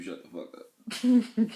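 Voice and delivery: high, slow, drawn-out mock scolding; hammed-up telling her off; husband playfully overacts his rude reply; mock angry snap. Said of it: "I think I told you".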